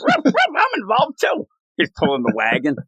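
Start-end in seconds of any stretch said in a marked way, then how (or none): 0.1-1.5 high-pitched talking dog; barking dog. Retold or imitated. Petey the dog joins the gang, high doggy voice. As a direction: bark it out like an eager pup. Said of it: "Petey's like".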